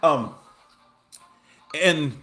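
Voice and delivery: ordinary delivery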